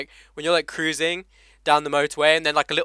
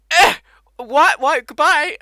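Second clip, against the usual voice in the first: mock disbelief; 11 semitones higher, 8 dB louder, slower than usual. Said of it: "And I'm just like".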